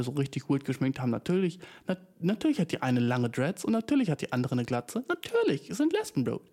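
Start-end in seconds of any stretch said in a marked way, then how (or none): 5.1-6.4 mocking. Mocking obviousness, very high.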